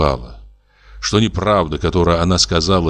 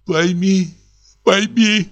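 much higher, broken sobbing plea; through sobs; 12 semitones higher, much slower than usual. Acted. Wife sobbing to husband, much higher broken plea. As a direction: plead while sobbing. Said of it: "выговорила сквозь рыдание".

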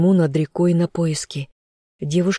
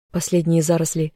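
plain reading voice

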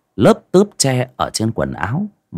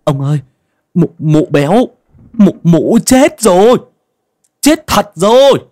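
much higher, stuttering in panic, slowing on chết; a scared youth blurting out what he saw; terrified stammer; 11 semitones higher, 7 dB louder than usual. A narrator's reading. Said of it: "giọng lắp bắp". (lắp bắp hoảng sợ, giọng cao vống)

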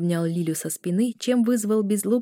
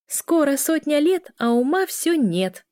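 high-pitched sisterly taunt; taunting cry; 8 semitones higher, 4 dB louder than usual. A narrator's reading. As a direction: call it out teasingly, slowing at the end. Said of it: "выкрикнула Эля и спряталась".

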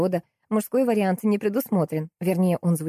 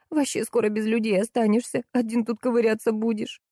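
higher, whiny scolding; squealing; 5 semitones higher than usual. A narrator's reading. Salesgirl berates her husband, mildly squealing. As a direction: keep up a mild squeal of complaint. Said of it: "неожиданно взвизгнула девчонка".

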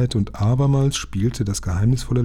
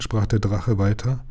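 ordinary delivery

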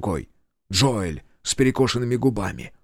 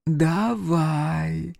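grandmother's whisper; 6 semitones higher than usual. Acted. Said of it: "прошептала бабушка Марта".

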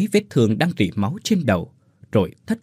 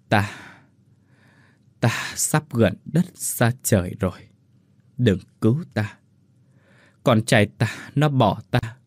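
faint dying voice. Acted. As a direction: speak barely alive. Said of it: "mà thoi thóp rằng". (giọng thoi thóp)

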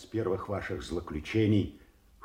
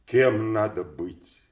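loud, slow, raised reproach; indignant protest; 4 semitones higher, 9 dB louder than usual. Acted. Servant defending his dying king's rest, indignant and loud. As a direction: protest hard, stretching the words.

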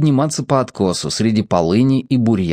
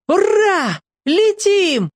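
joyful yell; much slower than usual. A narrator's reading. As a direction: shout with delight while racing. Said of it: "завопил Сушкин".

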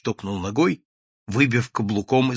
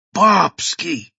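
higher, startled exclamation; gasp of recognition; 6 semitones higher, 4 dB louder than usual. A narrator's reading. Onlooker recognising the naked inventor, startled gasp. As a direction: exclaim the name in shock. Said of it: "ахнул Доброгласов".

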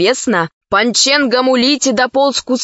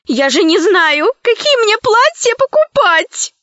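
put-on high, much higher, shouting girl; shouted; 10 semitones higher than usual. A narrator's reading. Slave girl Zauri shouts, desperate to know her size, high and insistent. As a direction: demand an answer at last. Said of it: "закричала рабыня Заури".

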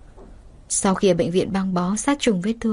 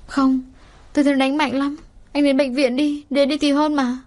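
put-on higher, urgent girl's voice; insistent young woman; 6 semitones higher than usual. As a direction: press him to go, worried. Kiều Anh nài Nam đi viện. (giọng cô gái cao, gặng nài lo lắng)

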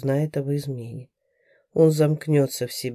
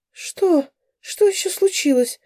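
much higher startled cry; alarmed; 13 semitones higher, 3 dB louder than usual. A narrator's reading. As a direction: react in sudden alarm. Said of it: "всплеснула ладонями Раиса".

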